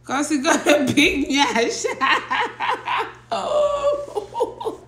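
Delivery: laughing through the words; cracking up mid-sentence. Real, not acted. Laughing at nyash joke.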